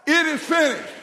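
high, loud rallying declaration. Shown as a demonstration. Preacher leading congregation to say it together, declaring. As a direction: lead the room in declaring it. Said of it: "we need to speak it out loud".

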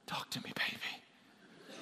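whispered, slow, hungry for it; slow eager whisper. Acted. Pastor acting out begging for good news, slow whisper. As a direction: breathe it like you crave it.

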